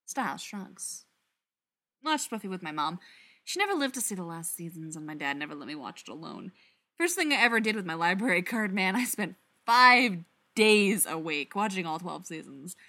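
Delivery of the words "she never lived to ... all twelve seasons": ordinary delivery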